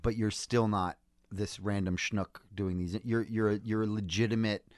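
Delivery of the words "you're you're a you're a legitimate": ordinary talk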